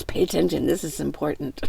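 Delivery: low, hushed, earnest voice telling you to listen; Holy Spirit, softly. Retold or imitated. The Holy Spirit urging the vlogger, quiet and earnest. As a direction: murmur it like a quiet inner voice. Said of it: "He goes".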